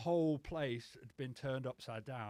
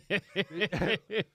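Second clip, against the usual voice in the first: loud, high burst of real laughter; a rising laugh at himself; big laugh; 7 semitones higher, 10 dB louder than usual. Real, not acted. Laughs at his own story.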